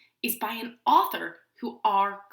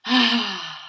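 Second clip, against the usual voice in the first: loud, breath-filled happy sigh; long contented exhale; big sigh; 3 semitones lower, 5 dB louder than usual. A narrator's reading. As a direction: sigh big, in love with a hot dog. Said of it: "he gives us a big sigh".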